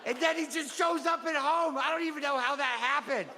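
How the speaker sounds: put-on high-pitched little kid telling about a favorite book; child's book report. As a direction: play a giggly child presenting his book.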